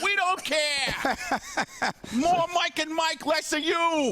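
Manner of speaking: high, mocking New York heckler yell; heckling fans. New York fans heckling Frank, in a high mock-angry yell. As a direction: heckle him like a rude New York crowd. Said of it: "In New York, they're like".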